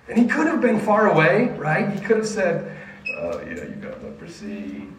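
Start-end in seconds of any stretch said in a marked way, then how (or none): 3.2-4.9 very low, soft, indifferent mumble; mock uninterested low voice; offhand dismissal. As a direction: brush them off without caring. Acting out a dismissive Jesus.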